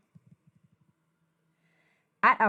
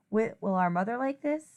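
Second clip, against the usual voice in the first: plain reading voice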